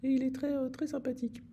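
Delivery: very high perched voice; high little voice. Retold or imitated. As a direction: squeeze it up high and small. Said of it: "il a une petite voix comme ça, bien perchée".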